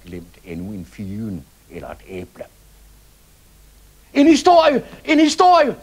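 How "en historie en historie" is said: high, loud eager chant; children shouting. Acted. Children begging the little fat man for a story, loud high shout. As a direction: yell it like excited kids begging. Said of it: "råbte børnene".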